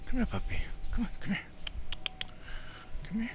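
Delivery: high coaxing puppy talk; talking to a dog. Real, not acted. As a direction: call the puppy over sweetly.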